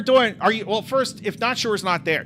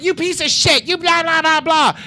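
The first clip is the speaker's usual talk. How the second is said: higher-pitched retold mother's tirade; angry mother; 10 semitones higher, 3 dB louder than usual. Mom bursting into the bedroom at night, angry. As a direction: imitate a mom laying into her son.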